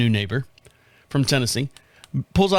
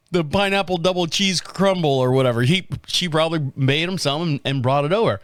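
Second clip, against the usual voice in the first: ordinary talk, nothing marked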